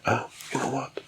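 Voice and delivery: hushed whisper, dialogue included; whispered storytelling. A narrator's reading. Kolobok offers the wolf a song, whispered.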